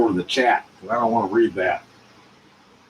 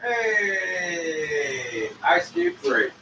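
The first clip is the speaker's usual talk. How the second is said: excited greeting; 6 semitones higher, 6 dB quieter, much slower than usual. Acted. Greeting an arriving guest.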